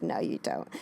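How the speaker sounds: low, quiet retold put-down; imitated dismissal. Wynonna turning down excited Waverly, imitated low dismissal.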